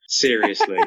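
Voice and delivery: ordinary delivery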